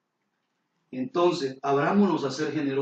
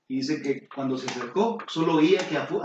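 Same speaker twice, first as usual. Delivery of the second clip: ordinary talk, nothing marked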